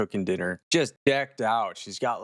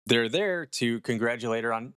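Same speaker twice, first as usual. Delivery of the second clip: ordinary talk, nothing marked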